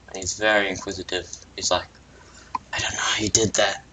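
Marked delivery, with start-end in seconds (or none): 2.7-3.8 quick whispered wonder; amazed whisper. Acted. Character whispering in wonder at the magic. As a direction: hush it out in awe.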